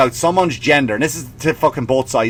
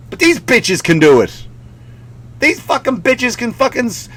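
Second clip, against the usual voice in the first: ordinary talk, nothing marked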